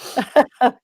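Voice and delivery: short amused giggle; a quick delighted laugh. Real, not acted. Laughing at being tickled.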